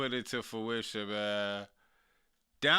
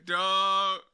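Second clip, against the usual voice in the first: ordinary talk, nothing marked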